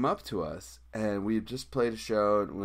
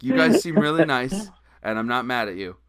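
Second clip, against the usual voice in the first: sugarcoat the rejection; fake-sweet loud; 3 semitones higher, 9 dB louder than usual. Sweetly telling off the band.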